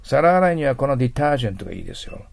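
normal talking voice

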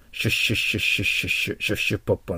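"シュッシュッシュッ" as a hissing steam-train chug; train-like "sh" sound. Shown as a demonstration. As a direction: repeat rounded, voiceless "シュッ" in steady bursts. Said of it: "のシュで発音しないといけません".

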